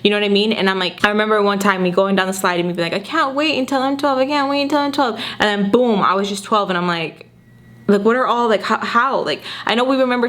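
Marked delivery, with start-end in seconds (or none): 3.0-5.2 lightly voiced eager child, pitched up; a hint of a little girl longing to grow up; imitating her kid self. Remembering herself as a kid on the slide, mildly childlike and eager.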